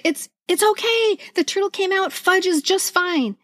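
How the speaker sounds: calm a worried kindergartener, gently; reassuring. Retold or imitated. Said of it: "And I said".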